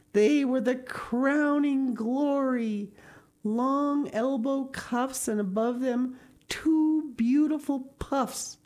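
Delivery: slow, lifted narration savoring every detail; rapturous awe. A narrator's reading. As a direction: draw out each phrase in wonder. Reader relishes the longed-for sleeves.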